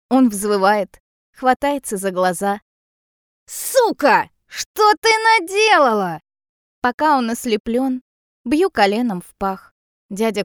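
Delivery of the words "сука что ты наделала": high, loud, drawn-out howl of outrage; pained outraged yell. A narrator's reading. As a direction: yell it as if blinded. Sprayed man howls in rage.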